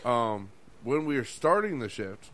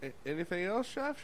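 high, soft, overly innocent question; timid squeaky question; 5 semitones higher, 5 dB quieter than usual. Retold or imitated. Cook innocently asking the furious chef for orders. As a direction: play dumb and sweet. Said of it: "I'm like".

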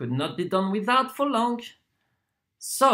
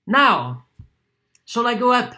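much louder, pointed question; loud demand; 4 semitones higher, 8 dB louder than usual. Acted. Lodger asks deaf landlady about going up, loudly. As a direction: raise your voice as if to the deaf.

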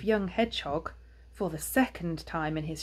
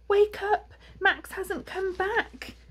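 high, alarmed wife's voice; worried mother hedgehog; 13 semitones higher, 3 dB louder, slower than usual. A narrator's reading. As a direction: wake him urgently, pitched high. Ma wakes Pa, worried.

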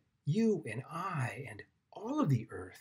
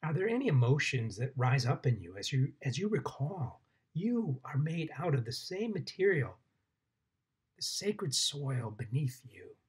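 guided meditation. Acted. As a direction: guide them gently into the ground, unhurried.

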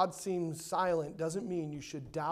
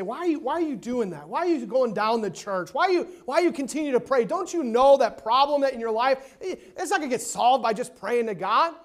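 raised, louder mock incredulity; scornful world; 4 semitones higher, 7 dB louder than usual. The world questioning a believer who prays, mock incredulous. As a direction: imitate a doubter hammering out challenge after challenge. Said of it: "The world looks at you and say".

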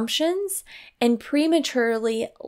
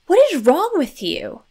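exasperated parent; 6 semitones higher, 5 dB louder than usual. Shown as a demonstration. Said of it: "is condemning the child".

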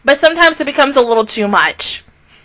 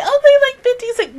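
mock kid voice; 14 semitones higher, much faster than usual. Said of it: "I'm like".

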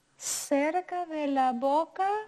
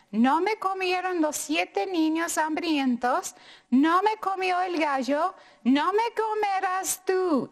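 tortilla boasting, louder and higher; defiant tortilla; 6 semitones higher, 4 dB louder than usual. Acted. Tortilla boasting to the cow, defiant and rising. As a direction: defy the hungry animal, rising.

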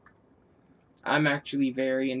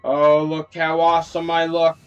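loud, delighted showing off; excited boast; 7 dB louder than usual. Real, not acted. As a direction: crow about how awesome you look. Showing off transformation.

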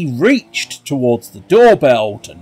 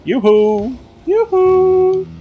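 high falsetto; slower than usual. Pretend.

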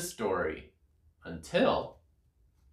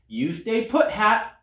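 higher, lightly voiced squirrel; 7 semitones higher than usual. A narrator's reading. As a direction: talk to the hat as a squirrel. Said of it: "said the squirrel".

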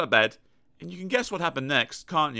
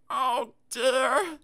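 high sorry little voice; sorry for himself; 11 semitones higher than usual. Acted. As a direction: sigh it out self-pitying. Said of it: "feeling very sorry for himself".

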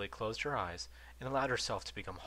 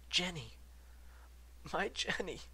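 choked; 10 semitones higher than usual. A narrator's reading. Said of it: "he managed to choke out".

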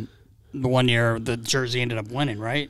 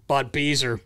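usual speaking voice

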